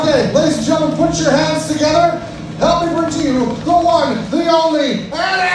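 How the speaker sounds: booming showman introduction; announcer hype. Acted. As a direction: announce him like a ring announcer. Announcing the creature's entrance.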